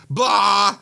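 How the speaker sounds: high, held "blah" in echo; nonsense yell back. A narrator's reading. Meg echoes the narrator's cry, high nonsense yell. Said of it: "And Meg goes".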